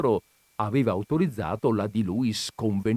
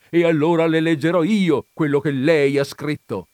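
loud, higher, forceful announcement; indignant; 7 semitones higher, 8 dB louder than usual. A narrator's reading. Visitor offering to read her own article, indignant. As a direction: take over, thoroughly indignant. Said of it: "strepitò l'altro".

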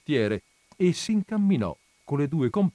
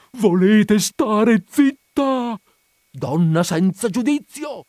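much higher, louder, slow angry shout; shouting at her; 11 semitones higher, 9 dB louder, much slower than usual. A narrator's reading. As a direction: yell it right into her ear. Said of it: "le gridò in un orecchio". (le grida in un orecchio)

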